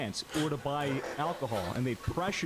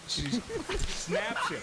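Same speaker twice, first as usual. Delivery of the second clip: laughing; 6 semitones higher, slower than usual. Real, not acted. Laughing while telling.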